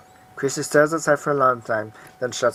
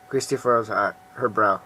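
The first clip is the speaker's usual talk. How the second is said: ordinary talk, nothing marked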